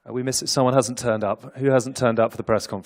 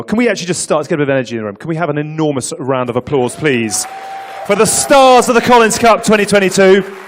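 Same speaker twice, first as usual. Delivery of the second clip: loud, high hype-up for applause; hyped announcer; 5 semitones higher, 12 dB louder than usual. Real, not acted. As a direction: pump up the crowd. Host hyping applause for athletes.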